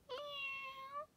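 very high soft meow; hummed meow. Acted. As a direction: hum a high little meow. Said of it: "hums the cat".

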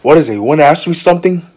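ordinary delivery

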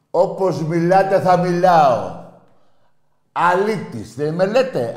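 real, heated yelling; angry shouting. Real, not acted. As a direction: throw it back at them at full volume. Angry retort to his critics.

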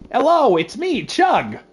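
high goofy character voice; squeaky dopey creature voice; Chug voice. Acted. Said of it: "this was the voice I gave him".

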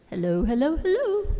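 rising mic-check hellos. Real, not acted. Speaker testing the microphone for the congregation, mic-check call climbing in pitch. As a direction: test the mic, last hello jumping high.

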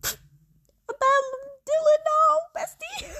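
very high loud wail; wailing protest. Real, not acted. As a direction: wail in despair at the arrest. Innocent friend arrested.

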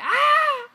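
sudden high, loud shriek; sharp scream. Real, not acted. Sudden shock in game.

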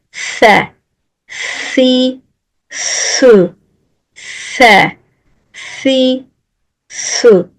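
"Se, si, su" each lisped, tongue tip out, slow; tongue between the teeth on "Se, si, su". Shown as a demonstration. Quran teacher drilling lisped "Se, si, su" syllables. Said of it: "dilimin ucu hafif dışarıda".

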